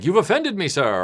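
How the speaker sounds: a hint of theatrical indignation; mock duel challenge. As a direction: act out a mildly offended challenge.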